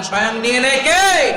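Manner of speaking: loud, high shouted question; soaring preacher's shout; rousing call. Real, not acted. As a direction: rouse the crowd to answer. Makes crowd shout the answer.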